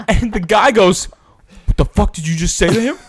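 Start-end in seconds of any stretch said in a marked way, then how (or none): 1.7-3.0 louder, higher mock confrontation; angry challenge. Stranger defending the roommate from an insult, angry challenge. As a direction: imitate a stranger picking a fight.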